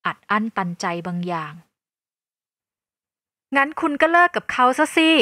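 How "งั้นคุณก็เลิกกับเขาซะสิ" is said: louder and higher, snapping back; loud retort. A narrator's reading. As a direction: fling the dare at her. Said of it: "ฉันพูดกลับไปเสียงดัง".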